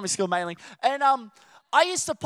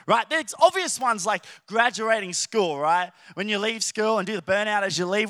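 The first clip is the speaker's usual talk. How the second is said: ordinary talk, nothing marked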